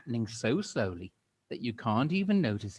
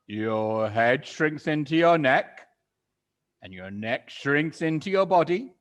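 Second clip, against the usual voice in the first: gloating Mister Twit; 2 semitones higher, 8 dB louder than usual. Acted. Mister Twit relishing threat.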